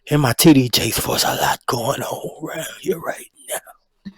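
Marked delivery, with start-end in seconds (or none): 1.2-4.1 hushed whisper, words barely voiced; secretive repeated whisper; whispered catchphrase. Shown as a demonstration. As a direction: whisper it like juicy gossip.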